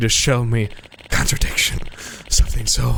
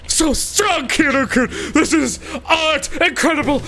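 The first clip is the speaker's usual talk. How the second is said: screaming ecstatic photographer; 12 semitones higher than usual. Acted. Photographer losing it over the model's scream, higher shouting. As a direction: howl with artistic joy.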